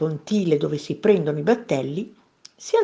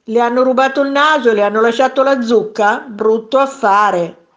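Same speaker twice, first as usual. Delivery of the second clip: loud, raised mocking call; mocking jeer; 3 semitones higher, 6 dB louder than usual. A narrator's reading. Onlookers tease the noseless man, mocking jeer.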